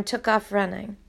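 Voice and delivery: plain reading voice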